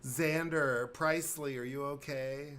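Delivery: put-on a fretful mom checking on her kids; worried parent. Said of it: "panicking parent".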